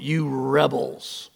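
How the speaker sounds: slow, low, contemptuous; contemptuous rebels. Retold or imitated. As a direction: spit the word out slowly. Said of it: "worst thing he can call his people".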